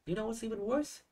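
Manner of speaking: "You know what's even worse" high and joined up, rising on "worse"; high-pitched linked run up to "worse"; linking phrase. Shown as a demonstration. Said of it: "nếu mà có được cái độ linking như này". (đọc nối liền, giọng cao, lên giọng ở "worse")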